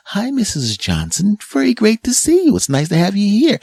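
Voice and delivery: very high, sugary friendly voice; sweet polite greeting. Retold or imitated. Shop stranger greeting you, very high and sugary. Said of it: "You need to hear".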